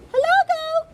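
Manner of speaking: very high squeaky duck greeting; duck character. A narrator's reading. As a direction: call out in a tiny, high-pitched duck voice. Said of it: "said Duck".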